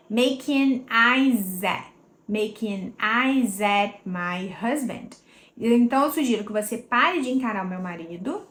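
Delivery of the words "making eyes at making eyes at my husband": "eyes at" linked into a z sound, said slowly; slow linking demo. Shown as a demonstration. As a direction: glide the s into a z. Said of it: "junta esse S com esse at, vira z". ("eyes at" ligado, com som de Z)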